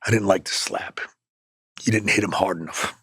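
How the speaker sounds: low and soft, a hint of a deadpan boss; low quiet Vince. Vince quietly telling him the slap was too soft, low and hushed. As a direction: drop the voice like a private verdict. Vince backstage, private verdict.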